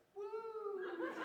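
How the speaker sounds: very high, long "woo"; high-pitched party woo; imitated whoop. Imitates Tammy's whoop again.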